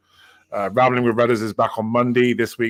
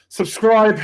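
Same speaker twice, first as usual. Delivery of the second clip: excited; 11 semitones higher, 8 dB louder than usual. Real, not acted. Hyping giveaway to viewers.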